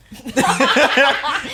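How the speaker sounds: high, loud laugh; burst of laughter. Real, not acted. Joking with co-host about the film, loud real laughter. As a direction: break into a big laugh. Laughs after own aside.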